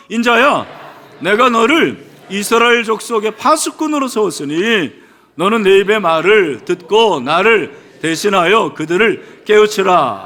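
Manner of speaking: loud, emphatic scripture reading; loud recitation. Real, not acted. Preacher leading the congregation in a second reading, loudly. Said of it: "다시 한번 우리 크게".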